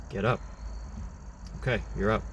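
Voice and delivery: understated, emotionless self-instruction; bland self-talk. Retold or imitated. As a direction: tell yourself blandly, no fuss. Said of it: "it was very bland".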